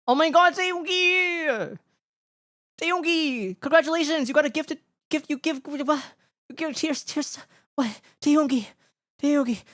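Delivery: very high-pitched delighted squeal; overjoyed, squeaky-high gushing; thrilled squealing. Real, not acted. Thrilled thanks for a gifted sub.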